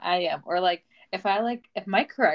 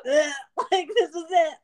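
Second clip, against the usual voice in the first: mock despair; 10 semitones higher than usual. Mock doom in the car.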